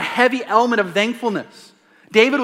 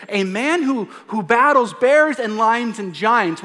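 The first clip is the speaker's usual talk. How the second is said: ordinary delivery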